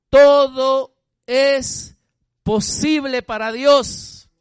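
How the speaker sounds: loud, slow, drawn-out preaching; full-force preaching. Acted. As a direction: preach it out, hammering each word. Preacher builds to climax. (predicación a todo pulmón, lenta y fuerte)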